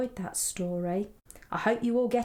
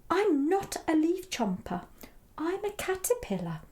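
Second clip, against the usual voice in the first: high little caterpillar voice; very small voice; 8 semitones higher than usual. A narrator's reading. Caterpillar corrects Edward, in a very small voice. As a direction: pipe up like a tiny creature. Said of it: "in a very small voice".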